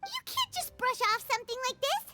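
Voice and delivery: indignant protest. Acted. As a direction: refuse to let it be brushed off. Paimon protests the dismissal.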